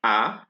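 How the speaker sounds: A as an open "ah"; French A sound. Shown as a demonstration. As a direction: say A as a drawn-out "ah". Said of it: "it's still A".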